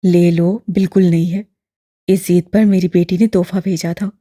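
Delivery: lightly voiced old man, smiling. A narrator's reading. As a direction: coax him with a smile. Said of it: "मुस्कुरा कर कहा".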